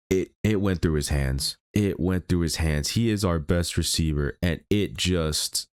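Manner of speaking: usual speaking voice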